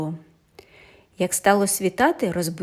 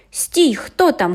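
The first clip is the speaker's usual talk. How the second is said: louder, higher, slow challenge; calling out; 5 semitones higher, 4 dB louder, slower than usual. A narrator's reading. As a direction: hail the approaching carriage. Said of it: "гукає Тодераш". (гучний окрик у темряву)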